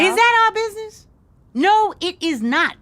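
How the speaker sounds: high, loud scolding; sharp rebuke. Acted. Mother scolding daughter for nosy question, sharp. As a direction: cut her off hard.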